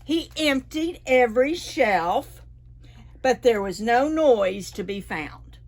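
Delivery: ordinary delivery, nothing marked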